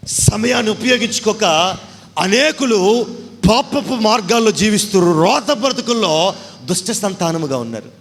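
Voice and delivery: high, loud warning; fiery preaching. Acted. Preacher warning sinners, loud and fiery. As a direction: drive the warning home.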